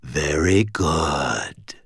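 very slow, low rasp; dry rasping whisper. A narrator's reading. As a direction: stretch it out in a dry, raspy voice. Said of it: "whispered a dry, rasping voice".

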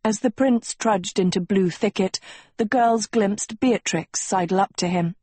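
ordinary delivery

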